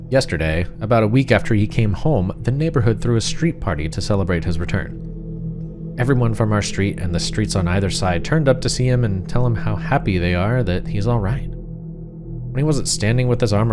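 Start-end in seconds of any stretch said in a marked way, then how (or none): none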